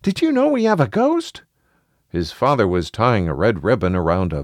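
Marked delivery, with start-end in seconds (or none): 0.0-1.4 put-on high-pitched child, slightly breathless; out of breath. A narrator's reading. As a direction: blurt it out like a winded kid. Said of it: "all out of breath".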